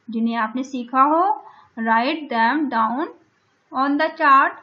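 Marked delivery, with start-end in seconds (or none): 1.8-4.5 slow task reading. Shown as a demonstration. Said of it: "teacher reading the task".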